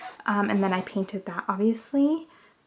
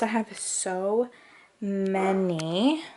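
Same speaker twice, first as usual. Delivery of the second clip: ordinary talk, nothing marked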